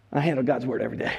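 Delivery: low, fast, smug brag rattled off; smug scribe. Acted. Preacher playing a scribe who looks down on a farmer, smug and fast. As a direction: toss it off like a self-important scribe.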